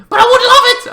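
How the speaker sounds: very high, loud gushing cry; high thrilled exclamation; excited squeal. Real, not acted. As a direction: burst out with delight. Gushing about Night Sister game.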